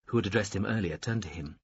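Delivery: ordinary delivery, nothing marked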